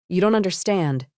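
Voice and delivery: ordinary delivery, nothing marked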